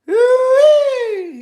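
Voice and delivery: high, loud whooping call; hollered answer. Retold or imitated. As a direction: whoop it back from far away. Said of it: "my neighbor hollered back".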